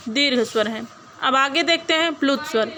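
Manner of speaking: usual speaking voice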